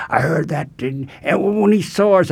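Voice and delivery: a sketchy try at Buckley's hip preacher voice; imitating Lord Buckley. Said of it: "I can't imitate him".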